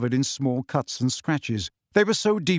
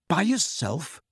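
impressed, questioning disbelief; amazed, impressed; 3 semitones higher, slower than usual. A narrator's reading. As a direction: ask with a touch of awe. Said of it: "amazed and impressed".

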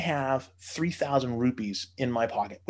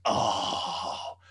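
dawning horror; 3 semitones lower, much slower than usual. Retold or imitated. Realising how it looked.

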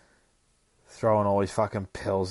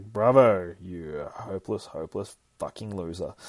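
self-mocking; 3 dB louder than usual. Tim scolds himself inwardly.

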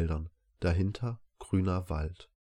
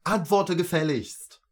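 slow, high, loud demand; angry shout; 11 semitones higher, 6 dB louder than usual. A narrator's reading. Father shouting while hitting his child, angry shout. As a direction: shout the order furiously. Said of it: "schrie er".

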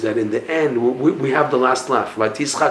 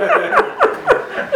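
high, helpless laugh; laughter. Real, not acted. Teacher laughing after the joke's punchline, high laugh. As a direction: crack up at your own joke.

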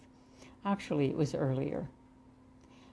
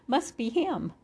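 laughing; 8 semitones higher than usual. Acted. Glee heard while travelling upward.

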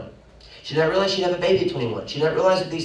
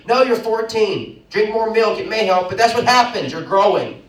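high, loud and fast; preaching at full force; 6 semitones higher, 6 dB louder than usual. Real, not acted. Preacher urging the church higher, full force. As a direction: surge up the mountain image.

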